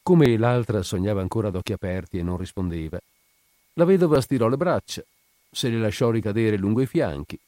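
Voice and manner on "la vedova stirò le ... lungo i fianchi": plain reading voice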